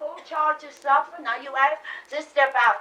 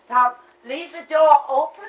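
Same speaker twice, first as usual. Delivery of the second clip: called out louder to her son; mother calls out louder to her son to leave the door open; calling out; 2 semitones higher, 10 dB louder than usual. Acted. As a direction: call across the room to Tom.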